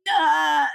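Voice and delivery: high loud raw cry; raw squall. Shown as a demonstration. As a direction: let out a rough human squall. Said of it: "You would never hear".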